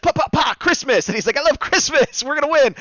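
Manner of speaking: excited cheer. Retold or imitated. Green Ranger cheering about Christmas in battle, high gleeful yell. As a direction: play the Christmas-crazed hero fired up mid-fight.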